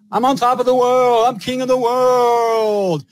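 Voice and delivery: high, slow crowing cry; triumphant crowing. Real, not acted. Host crowing to lovely Laura about being king, high triumphant crow. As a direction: proclaim it like you're king of the world.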